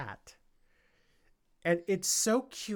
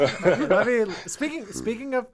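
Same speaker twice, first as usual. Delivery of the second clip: laughing; 6 semitones higher, 8 dB louder than usual. Real, not acted. Laughing after group banter.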